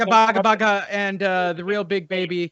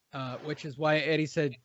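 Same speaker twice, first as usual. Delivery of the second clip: normal talking voice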